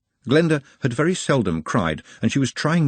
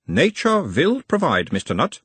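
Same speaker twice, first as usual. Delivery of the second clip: put-on a mild Uberwaldian accent, the higher voice of a gentle examiner; 7 semitones higher than usual. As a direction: question him in a light Uberwald accent, calming the patient. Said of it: "in a mild Uberwaldian accent".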